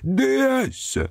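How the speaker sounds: very high wailing yell; the pig bawling his answer; bellowing pig-man. A narrator's reading. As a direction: squeal the word out. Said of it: "gueulait celui-ci".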